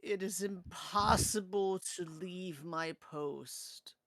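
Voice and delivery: slow, drawn-out ghostly lament; mournful drawn-out undead voice; cursed spirit. Acted. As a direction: speak slowly as a doomed, cursed spirit.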